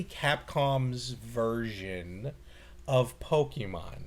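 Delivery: slow, deliberate over-enunciation; talking slow. Real, not acted. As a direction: spell it out slowly, word by word. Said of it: "Why are you talking slow?".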